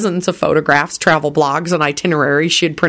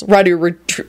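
ordinary delivery

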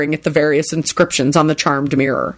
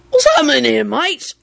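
put-on tipsy, higher voice of a cheery drunk; happy drunken slur; 8 semitones higher than usual. A narrator's reading. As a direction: slur it out happily, pitched up. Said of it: "Victor slurred out happily".

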